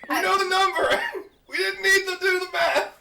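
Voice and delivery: loud, high-pitched laughing outcry; helpless, high laughing objection; laughing protest. Real, not acted. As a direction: shout it through real laughter.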